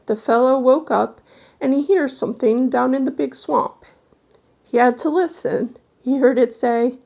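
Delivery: put-on old folk tale told to a hushed room; spooky story narration. A narrator's reading. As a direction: draw the listener into a ghost tale. Reads a spooky folk tale aloud.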